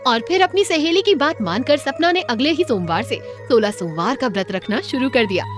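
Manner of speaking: plain reading voice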